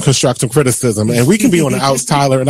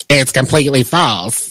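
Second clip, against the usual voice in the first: jokey put-on character voice; altered voice, played for laughs; Madea; 3 semitones lower than usual. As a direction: read the denial in a badly faked impression. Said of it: "That was my Madea voice".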